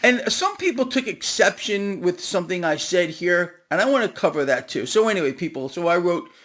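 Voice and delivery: normal talking voice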